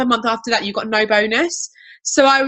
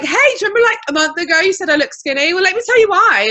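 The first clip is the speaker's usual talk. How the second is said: eager pitch; 7 semitones higher, 4 dB louder, faster than usual. Retold or imitated. Pitching to a coworker.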